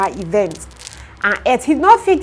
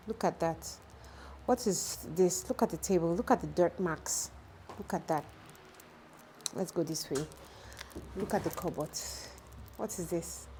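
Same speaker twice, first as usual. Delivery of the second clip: quiet tour; 2 semitones lower, 15 dB quieter than usual. Real, not acted. Showing viewers the carpenter's dirty furniture in a soft, hushed voice. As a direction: murmur as you walk the camera round.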